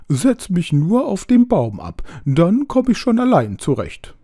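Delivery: a panicky owl ordering its rescuer around; bossy owl. A narrator's reading. Wet owl bossing the narrator to carry it to a tree, high and urgent.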